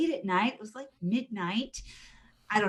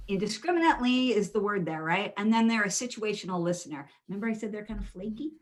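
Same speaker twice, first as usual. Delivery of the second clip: usual speaking voice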